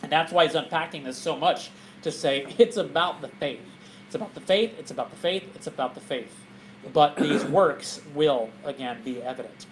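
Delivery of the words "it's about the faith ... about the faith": each repeat softer, dropping away; fading repetition. Real, not acted. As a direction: let it trail to near quiet. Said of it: "repeated phrase fading".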